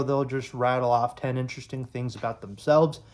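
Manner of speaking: ordinary talk, nothing marked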